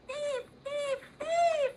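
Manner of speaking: high urgent squawking; crow squawk alarm. Acted. Crow chases the robber.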